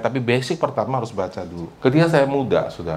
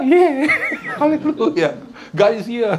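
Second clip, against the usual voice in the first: high, laughing hammed-up hallelujah; mock worship cheer; 11 semitones higher, much slower than usual. Preacher mocking his own tone-deaf praise, laughing cheer. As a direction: play the clueless worshipper cheering along. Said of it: "Kalau saya mah".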